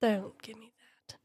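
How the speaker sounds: hissed dismissal; dismissive whisper. Acted. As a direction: brush it off in a hush.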